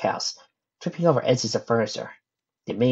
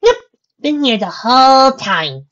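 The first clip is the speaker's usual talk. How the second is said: put-on high, loud, drawn-out girl's claim; Mabel's voice; 12 dB louder, slower than usual. A narrator's reading. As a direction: stretch it out high and innocent. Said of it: "claimed Mabel".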